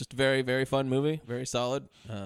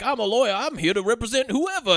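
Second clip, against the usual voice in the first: higher-pitched sleazy New Orleans lawyer; New Orleans accent; 8 semitones higher than usual. Retold or imitated. Co-host mimicking the movie's sleazy lawyer, hammed-up New Orleans accent. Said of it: "these terrible New Orleans accents".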